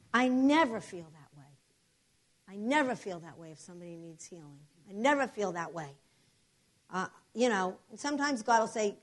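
ordinary delivery

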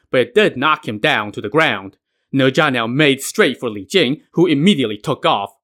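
ordinary delivery

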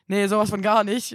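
loud, flat-out no; emphatic denial. Retold or imitated. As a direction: deny it firmly. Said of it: "Und ich war so".

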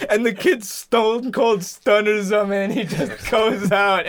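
ordinary delivery

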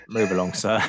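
put-on light Cockney policeman accent; a hint of a Cockney bobby. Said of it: "in a Cockney accent".

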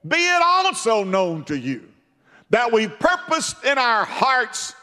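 high, loud preaching defiance; loud high defiant stand; defiant Hebrew boys. Retold or imitated. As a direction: hurl it at the king. Hebrew boys defy the king.

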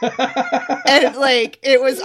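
talking through laughter; words breaking into a laugh. Real, not acted. Laughing at cult story.

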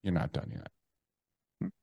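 a quiet, stern inner command; stern inner boss voice. Acted. Inner voice telling him to keep repenting, quiet and stern. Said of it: "that voice came back in".

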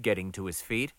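ordinary delivery, nothing marked

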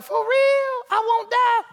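put-on very high, girlish Eve; Eve, tempted by the snake, in a high girlish voice. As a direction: pitch right up, amazed and naive. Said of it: "That's my Eve voice".

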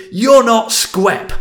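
high, loud, slow and scornful; slow furious jab. Retold or imitated. Engineer sneering at the new boss, high loud slow shout. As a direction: spit each word out at him. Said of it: "he just went".

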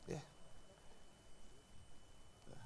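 ordinary talk

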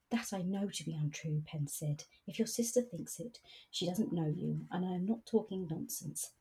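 reads the romance aloud at an easy, even pace; story reading. A narrator's reading. Reading a novel aloud to a friend, storytelling voice. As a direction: read the novel aloud to the book club.

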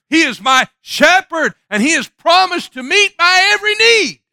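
loud, high preaching climax; rising sermon climax; preaching at full force. Acted. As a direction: build it to a shout of praise.

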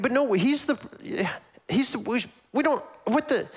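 high, halting objection that keeps breaking off; flustered, mock-indignant sputter; sputtering protest. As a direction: stammer in mock disbelief. Acting out the people's sputtering protest.

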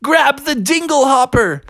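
high-pitched urgent mermaid cry; urgent Ariel. Acted. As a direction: call out an order, pitched right up. Urgent cry in a chase story.

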